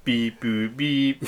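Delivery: toy-robot beep-boop noises. Pretend. Host recovers the failed robot bit with robot beeps. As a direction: imitate a little robot beeping. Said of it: "like, a robot voice".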